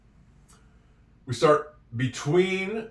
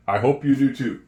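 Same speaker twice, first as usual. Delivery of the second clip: ordinary talk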